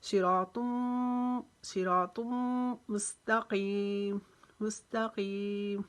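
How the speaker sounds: drawn-out Quranic chant; slow recitation. Shown as a demonstration. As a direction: stretch each syllable like a recitation. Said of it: "मीम मुशद्दद का गुना करेंगे".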